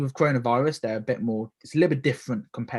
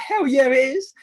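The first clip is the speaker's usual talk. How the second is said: rising, louder, gleeful voice climbing high; excited exclamation; 12 semitones higher, 5 dB louder than usual. Retold or imitated. Tasting free lemonade, gleeful and loud. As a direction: play up delight at the free sample.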